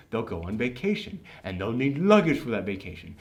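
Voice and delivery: low, soft, measured pitch; imitated earnest advice. Christopher Robin advises the boardroom, low and earnest. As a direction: lay out the plan softly and deliberately.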